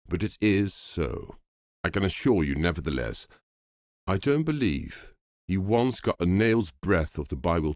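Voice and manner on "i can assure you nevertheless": usual speaking voice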